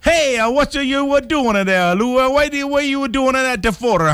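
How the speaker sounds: very high, hammed-up Italian accent; Southern Italian accent. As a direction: pester them in cartoon Southern Italian. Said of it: "authentic Southern Italian accent".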